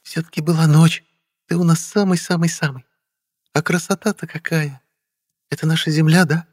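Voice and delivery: высокий женский голос, восхищение без крика; восхищайся тонким голосом, негромко; Вера. A narrator's reading. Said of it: "завопила Вера". (восторженная женская реплика в высоком регистре)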